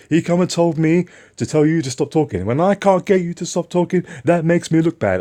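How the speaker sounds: high, loud mock scolding of the boss; retold angry boss.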